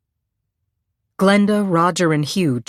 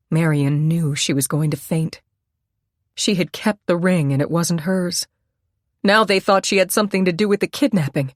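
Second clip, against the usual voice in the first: ordinary delivery, nothing marked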